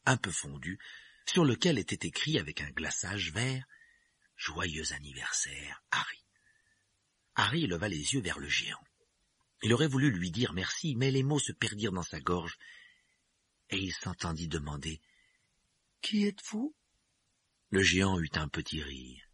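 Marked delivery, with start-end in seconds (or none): 4.3-6.2 whisper. Acted. Reading the cake icing. 16.0-16.8 put-on young Harry voice. Harry asks the giant.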